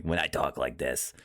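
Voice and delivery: low-pitched New Yorker voice; New Yorker accent. Shown as a demonstration. Showing friend the New Yorker thing, low New York accent. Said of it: "the New Yorker thing".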